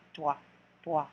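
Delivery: trois said twa, r swallowed; trois, a quick twa; French trois. Shown as a demonstration. Teacher modelling the number three in French: trois as twa. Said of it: "pronounce the T, R, W".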